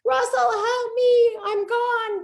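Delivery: high, loud cry for help, hammed up; mock panicked scream. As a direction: scream for rescue in playful, exaggerated panic. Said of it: "I used to scream".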